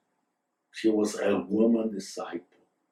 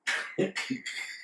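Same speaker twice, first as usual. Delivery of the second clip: soft, breath-led laugh; short chuckle; 9 semitones higher, 9 dB quieter than usual. Real, not acted. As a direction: chuckle quietly at your own story. Laughs at own story.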